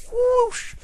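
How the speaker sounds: high, loud whoosh; mouth-made rushing whoosh; whoosh sound. Acted. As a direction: swoosh away from the tangent. Tangent sound effect.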